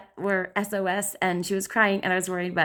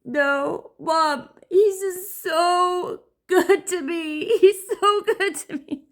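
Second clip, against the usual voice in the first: much higher, louder, slow sob-laugh; imitating sobbing daughter; 10 semitones higher, 5 dB louder, much slower than usual. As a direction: blubber it, laughing through tears.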